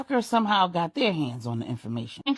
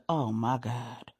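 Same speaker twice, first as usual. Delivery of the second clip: soft, slow, lowered voice in shock; hushed dismay; 8 semitones lower, 6 dB quieter, slower than usual. A narrator's reading. Angela stunned by the news of the break-in, slow and hushed. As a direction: breathe it out low and slow, stunned. Angela hears house was invaded.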